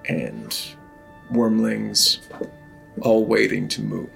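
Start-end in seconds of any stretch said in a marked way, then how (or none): none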